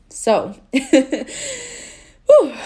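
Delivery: a loud, high laugh out of tears; relieved, tearful laugh and exhale; teary laugh. Real, not acted. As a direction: laugh off the crying with a whew. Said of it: "laughs after tearing up".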